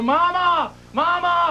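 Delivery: put-on high-pitched child's cry for Mama; imitated trapped child. Imitating four-year-old calling from inside the wall, high cry. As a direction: call out high, like a little kid stuck. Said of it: "you hear him go".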